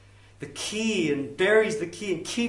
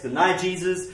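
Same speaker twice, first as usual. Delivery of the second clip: normal talking voice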